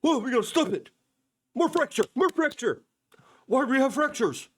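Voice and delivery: high, whiny mock panic. Host mocking Bongino's fracture talk, high and whiny. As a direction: parody a clueless fretting guy. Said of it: "And you're like".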